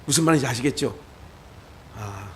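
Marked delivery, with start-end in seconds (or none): 2.0-2.3 low, soft, self-assured murmur; a believer keeping calm before an angry man, softly; calm and composed. Shown as a demonstration. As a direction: settle yourself calmly. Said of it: "그렇게 하는 거예요".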